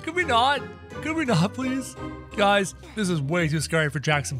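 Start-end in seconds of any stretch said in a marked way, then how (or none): 0.0-2.0 high nervous plea; scared pleading. Real, not acted. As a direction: beg it to stop. Scary game.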